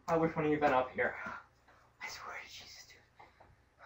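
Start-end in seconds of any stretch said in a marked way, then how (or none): 2.0-3.8 shaken whisper. Real, not acted. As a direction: breathe it out, still shaken. Shaken after the scare.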